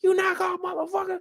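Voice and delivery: high screaming curse; screeching insult. Retold or imitated. Imitating Marquisha going off, high shrieking. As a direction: shriek it at her. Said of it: "Marquisha going off".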